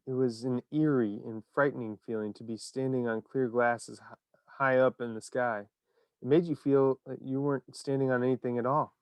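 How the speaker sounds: ordinary delivery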